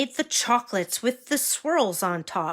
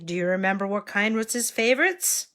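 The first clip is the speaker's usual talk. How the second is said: plain reading voice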